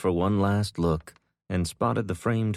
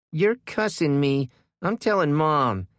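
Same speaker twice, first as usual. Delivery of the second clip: put-on high, loud whiny kid; little sister threatening to tell; tattling child; 9 semitones higher, 4 dB louder than usual. A narrator's reading.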